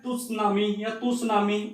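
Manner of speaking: टुसनामी, तुसनामी with the T sounded; wrong pronunciation. Shown as a demonstration. Teacher showing how some people mispronounce it, T sounded. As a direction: slowly voice the initial T in टुसनामी, तुसनामी. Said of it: "ये गलत है".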